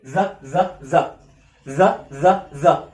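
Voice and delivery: "ذا" with a buzzing tongue-out th; voiced TH. Shown as a demonstration. Teacher demonstrating TH like Arabic dhal, voiced th. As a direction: repeat "ذا" in short separate beats, voiced th. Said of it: "بنطقها زي حرف الذال".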